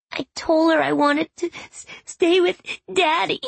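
put-on high little-girl voice, stammering through tears; sobbing child. A narrator's reading. Granddaughter confesses to her grandmother, high tearful sobbing.

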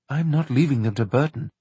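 plain reading voice

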